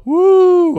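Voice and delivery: a very high, loud mock whoop; crowd cheer. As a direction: whoop like an excited audience. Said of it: "And they go".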